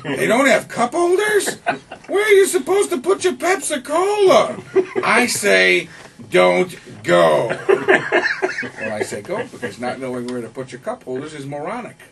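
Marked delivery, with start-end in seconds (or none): 0.0-9.4 put-on high, loud cranky old man; old movie critic. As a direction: rant like a grumpy old critic. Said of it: "characterizations with Pacino and De Niro".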